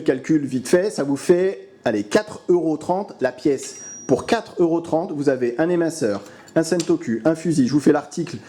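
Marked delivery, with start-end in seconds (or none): none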